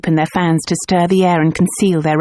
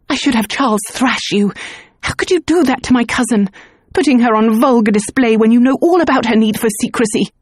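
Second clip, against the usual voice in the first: higher, rising indignation; furious scolding; 5 semitones higher than usual. Acted. Alicia scolding Luke for exposing her cousin, furious. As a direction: tear into him.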